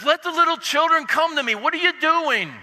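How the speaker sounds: scolding in a hammed-up Brooklyn accent. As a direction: call them out like a Brooklyn guy. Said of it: "In the best possible Brooklyn accent".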